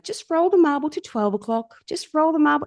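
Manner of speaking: high-pitched coaching instruction; coaxing coach. Retold or imitated. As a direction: coax a reluctant client, pitched well up. Coaching voice in retold scene.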